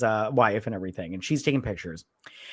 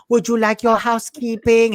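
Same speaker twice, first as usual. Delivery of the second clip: high, loud, hammed-up Moroccan-French accent; mock Moroccan-French accent; 9 semitones higher, 7 dB louder than usual. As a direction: imitate a badly faked Moroccan accent with French mix. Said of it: "Moroccan with French mix".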